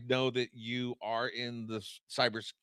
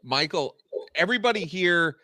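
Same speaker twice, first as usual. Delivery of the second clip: loud call out; 5 semitones higher, 14 dB louder than usual. Real, not acted. Cutting in to rally listeners.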